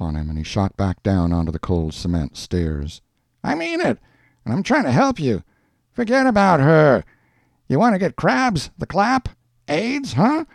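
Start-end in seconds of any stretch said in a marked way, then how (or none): 3.4-10.5 high, insistent street voice; pushy neighbour. A narrator's reading. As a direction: press the warning hard, pitched far up. Hank pressing his warning on Schultz.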